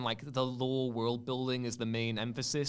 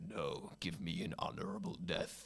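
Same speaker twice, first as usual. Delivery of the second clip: much lower, much softer, grave warrior voice; a deep solemn plea for an honorable death; Ravis impression; 8 semitones lower, 6 dB quieter than usual. Ravis asking Cal for death, low grave voice. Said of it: "That's a good, uh, impression".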